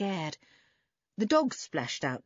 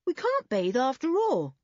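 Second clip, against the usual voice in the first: higher-pitched, disappointed protest; dismay; 7 semitones higher, 3 dB louder than usual. A narrator's reading. Boy finding the pool is forbidden, dismayed. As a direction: let the disappointment lift the voice. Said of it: "said Dick in dismay".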